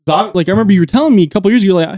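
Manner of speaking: ordinary delivery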